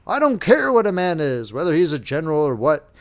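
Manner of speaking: rising to a loud angry outburst; firm, undaunted. A narrator's reading. Lieutenant defying the colonel over the general's insult, firm and loud. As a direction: stand firm and let the anger swell to a shout. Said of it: "spoke suddenly in firm and undaunted tones".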